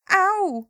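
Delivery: mother yelping in pain; sudden yelp of pain. Acted. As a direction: cry out as the nettles sting. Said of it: "Vyjekla najednou maminka".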